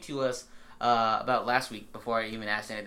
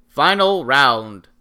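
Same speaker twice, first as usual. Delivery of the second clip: slow booming final round call; booming announcer; 14 dB louder, much slower than usual. Pretend. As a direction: drag it out like an arena announcer. Announcer call after plain mention.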